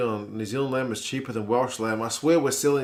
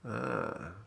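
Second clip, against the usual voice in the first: normal talking voice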